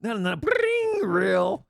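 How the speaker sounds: high, slow pleading no no no; mock desperate plea. Host mocking himself begging in the bathroom, high whiny plea. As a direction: whine it up high like begging for a reel. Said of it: "I'm like".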